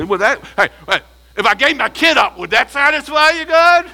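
mock-generous sarcasm building in pitch; sarcastic. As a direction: sneer it up as a sarcastic bargain. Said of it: "it smacks with sarcasm".

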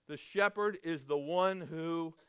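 ordinary talk

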